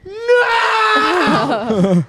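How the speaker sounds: very high shouted no; anguished cry. Retold or imitated. Imitating the bunny freaking out, high anguished scream. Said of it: "freak out like".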